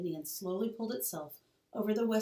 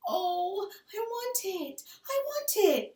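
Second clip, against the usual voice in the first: put-on high, pleading child's voice; begging; 12 semitones higher, slower than usual. Little Laura begs Pa for the baby, high and pleading. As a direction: beg like a small child who wants it badly. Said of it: "Laura begged".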